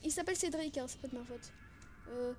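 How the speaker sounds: ordinary delivery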